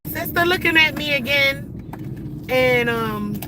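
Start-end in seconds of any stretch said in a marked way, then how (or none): 0.1-2.5 quoted self. Retold or imitated. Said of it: "I told her sister".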